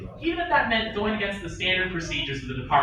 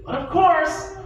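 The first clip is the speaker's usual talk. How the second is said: ordinary delivery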